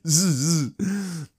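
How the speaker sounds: drawn-out buzzing chainsaw noise. Retold or imitated. Aunt cutting down the tree, mimicked chainsaw buzz. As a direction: buzz like a saw through wood.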